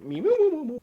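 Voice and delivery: playful high, loud mee-moo noise; imitated floating-star sound. Player miming a little star floating round a head, playful sound effect.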